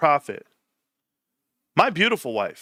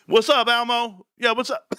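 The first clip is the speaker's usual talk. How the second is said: acted phone greeting; 8 semitones higher than usual. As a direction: imitate hailing a friend on the phone, pitched up. Acts himself calling out on phone.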